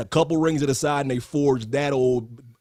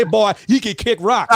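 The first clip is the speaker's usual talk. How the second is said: Foghorn Leghorn; 6 semitones higher, 6 dB louder than usual. Retold or imitated. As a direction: bellow it like the cartoon rooster. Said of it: "[name] can't touch Foghorn Leghorn".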